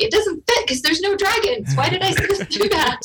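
high mock voice, exasperated at herself; mock exasperation. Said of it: "I'm like".

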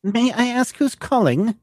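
screen the caller politely; secretary voice. Acted.

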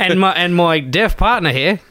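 broad mock Aussie; hammed-up Aussie bloke; Australian accent. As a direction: lay on a thick Australian accent. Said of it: "doing Australian accents".

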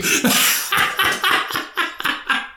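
long high wheezing laugh; helpless high laughter; real cracking up. Real, not acted. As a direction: burst out laughing at your own slip. Slipped and said the name.

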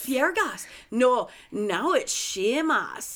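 slow, swooping mock Irish; fake Irish accent. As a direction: ham up an Irish lilt, drawn out. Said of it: "He's Irish, so".

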